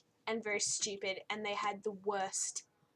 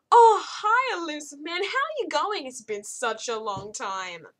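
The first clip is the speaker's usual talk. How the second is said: much higher and far louder, syrupy greeting; mocking gushy Arya; 5 semitones higher, 11 dB louder than usual. Arya greeting the Elusive Man, mock gushing, high and loud. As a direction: gush like an overexcited old friend.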